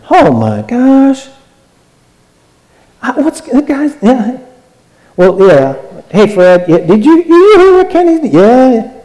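high mocking gossip impression; church gossip. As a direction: ham up the juicy whisper-network gossip. Acting gossip about Kenny.

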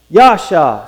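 normal talking voice

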